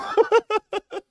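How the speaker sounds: laughing high and loud; high-pitched laugh. Real, not acted. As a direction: crack up with laughter. Laughing at the mukbang joke.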